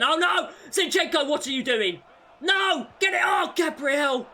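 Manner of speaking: high, loud panicked yelling; frantic shout. Real, not acted. Gamer screaming at his defender's mistake. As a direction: yell at the screen in alarm.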